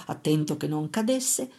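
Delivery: ordinary delivery, nothing marked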